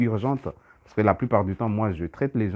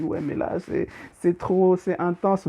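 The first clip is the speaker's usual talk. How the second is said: higher, slowed, strained plea; overwhelmed patient; 9 semitones higher than usual. Retold or imitated. Patient tells the healer it is too much, higher strained plea. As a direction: voice her being at the limit.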